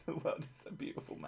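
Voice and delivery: whispered through laughter; breathy laughing awe. Real, not acted.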